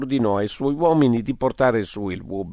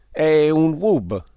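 slow, higher, drawn-out naming; slow announcement; 6 semitones higher, 4 dB louder, much slower than usual. Acted. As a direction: present the creature slowly and proudly. Peterson presenta l'animale. (lento, voce più alta, annuncio solenne)

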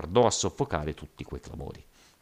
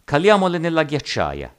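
raised, much higher call; called-out order; 10 semitones higher, 8 dB louder than usual. A narrator's reading. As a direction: call out the suggestion to the group. Urging companions to lower the ropes.

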